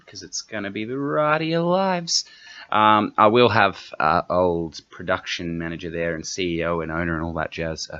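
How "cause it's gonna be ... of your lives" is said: mock epic hype. Hyping a wild ride.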